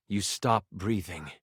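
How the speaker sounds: low, slow, hushed narrator; grave death narration. A narrator's reading. Narrator tells the player they have died, grave and hushed. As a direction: let it sink low and slow, with pauses.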